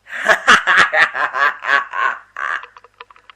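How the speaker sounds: loud gloating villain laugh; evil laugh. Acted. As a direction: cackle in triumph. Villain gloats over plan.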